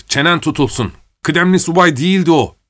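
high-pitched irritated outburst; angry cursing. A narrator's reading. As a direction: snap back in exasperation, pitch climbing. Officer cursing in an acted quarrel.

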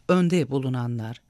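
plain reading voice

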